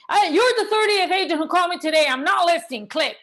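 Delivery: fast, loud, high irritated brush-off; annoyed seller. Retold or imitated. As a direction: snap at a pushy caller and hang up. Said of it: "that's really what they sound like".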